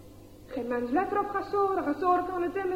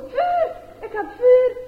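higher, louder and slow triumphant shout; excited cry; 9 semitones higher, 10 dB louder, slower than usual. Acted. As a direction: announce your victory to everyone. Gasore gets his fire.

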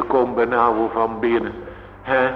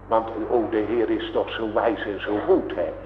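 hushed tender aside; 5 semitones lower, 7 dB quieter, faster than usual. Real, not acted. Tender aside on God's goodness.

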